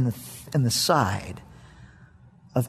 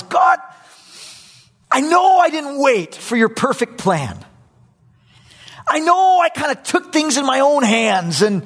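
high, louder pleading to God; pleading confession; 8 semitones higher, 3 dB louder than usual. Acted. Abraham pleading with God, high and loud. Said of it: "what he's saying to God is".

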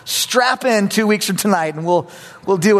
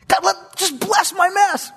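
much higher whining plea; whiny plea; 13 semitones higher than usual. Acted. Abraham begging God, high and whining. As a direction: whine it up high like a child begging. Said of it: "what he's saying to God is".

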